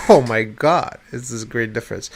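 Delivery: ordinary delivery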